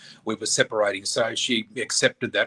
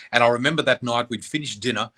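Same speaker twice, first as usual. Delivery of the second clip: ordinary delivery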